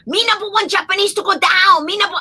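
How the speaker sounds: high-pitched mock Japanese accent. Comedian mimicking annoying Japanese passenger, high Japanese accent. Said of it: "the heavy Japanese accent".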